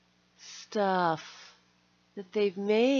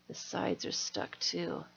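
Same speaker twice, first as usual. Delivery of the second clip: whisper; 3 semitones lower, 7 dB quieter than usual. Real, not acted. Muttered to self.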